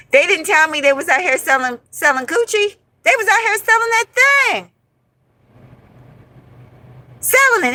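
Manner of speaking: rising to a high, scandalised pitch; outraged disbelief. Real, not acted. Outraged about friends selling sex.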